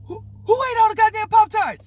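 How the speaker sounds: high, loud stuttered outburst; mad stuttering. Retold or imitated. Mad dad demands who ate the Pop-Tarts, stuttering. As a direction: stammer on the first word, then burst out high and angry. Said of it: "he's mad. So he's stuttering".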